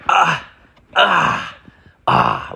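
hammed-up high fake orgasm moans; faked moans. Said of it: "like, just made the noise".

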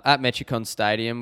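ordinary talk, nothing marked

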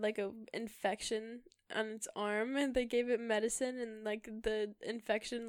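normal talking voice

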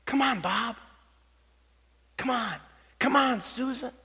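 soft imagined cheer from afar; hushed urging. Pretend. Voice of the one cheering on each runner, hushed urging. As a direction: coax them on softly, like a distant voice.

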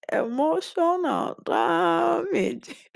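high, slow, drawn-out "Emotional damage"; meme quote. Retold or imitated. Vlogger quoting a funny viral video, in a high, slow meme voice. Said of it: "funny video that said".

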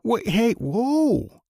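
high, sudden cry of disbelief; startled whoa. Real, not acted. Host cuts off the preacher's clip, high startled whoa.

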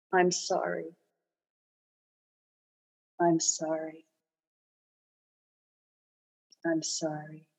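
fading apology. Acted. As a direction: let the apology dissolve into quiet. Repeated apology fading away.

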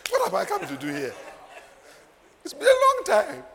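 high-pitched, amused mock protest; laughing retelling. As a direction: protest playfully through rising laughter. Laughing through own retold reply.

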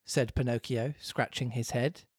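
usual speaking voice

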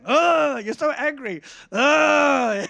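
high, loud mock growl on each Oh; exaggerated growl, thrown back teasingly. Preacher growling back at the angry driver, mock growl. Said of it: "the more I growl back at him".

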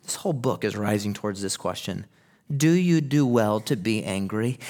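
usual speaking voice

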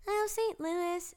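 put-on high, drawn-out little kid voice; third grader voice. Third grader answering where they're going, high kid voice. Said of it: "freaking idiot third grader".

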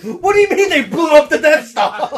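high, loud laughing disbelief; quoting Vader. Retold or imitated. Host quoting his favorite Vader line, high and laughing. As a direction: laugh through the shocked line. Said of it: "I just love that pause".

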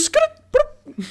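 high, loud shoo; high loud mock scolding. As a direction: shoo someone off shrilly. Shooing bit.

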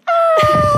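high, loud bratty scream; tantrum scream. Retold or imitated. Aunt imitating bratty niece screaming, loud. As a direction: shriek like a spoiled kid. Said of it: "la otra así".

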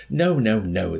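loud, high first no, falling away; protesting. A narrator's reading. Chaplain to his interrogators, miserable protest. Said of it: "the chaplain protested".

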